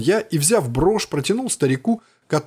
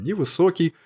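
usual speaking voice

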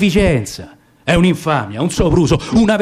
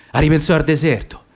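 ordinary delivery, nothing marked

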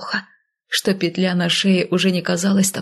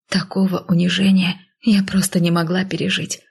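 ordinary delivery, nothing marked

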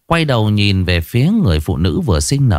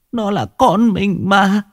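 high, slow, faltering plea; a new mother pleading with her husband, slow and high; weak pleading; 11 semitones higher, slower than usual. Acted. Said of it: "cô ta thều thào".

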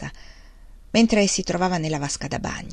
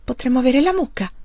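higher, wondering tone; mildly astonished, pitched up; amazed; 5 semitones higher than usual. A narrator's reading. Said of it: "Lei disse stupita".

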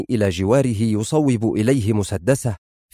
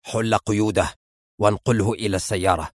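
lowered, controlled voice of command; understated authority in a deeper register; 3 semitones lower, 3 dB quieter than usual. A narrator's reading. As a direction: give the order low and firm. William gives orders to John.

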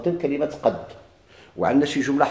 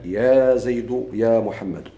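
slow example; much slower than usual. Shown as a demonstration. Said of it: "في الجملة النداء".

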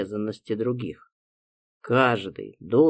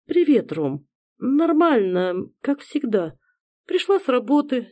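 higher, lightly voiced sister; young woman voice; 11 semitones higher than usual. Sister voiced by male narrator.

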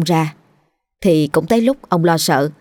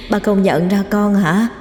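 haunting and sing-song, a touch higher; gloomy ghost voice; 3 semitones higher than usual. A narrator's reading. Ghost daughter teases her father in the dark, lightly eerie. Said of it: "giọng nói âm u".

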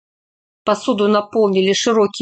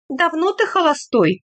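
higher, probing question; pointed personal question, pitched up; unusual curious note; 7 semitones higher than usual. A narrator's reading. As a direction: ask with a new note of interest in the voice. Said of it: "в голосе послышалась необычная нотка".

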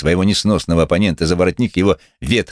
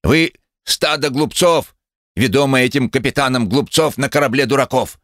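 agitated cry; 9 semitones higher than usual. A narrator's reading. Wooden-legged man denounces the jeering crowd, agitated. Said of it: "возбуждённо повернувшийся".

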